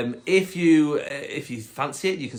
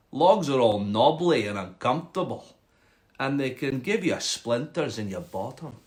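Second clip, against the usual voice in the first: put-on grumbling frog with a Scottish accent. Frog complaining to the cat about logs, Scottish accent. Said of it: "a Scottish frog".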